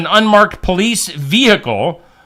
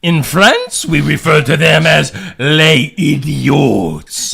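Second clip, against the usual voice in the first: put-on French accent; slower than usual. Mock French in the bit.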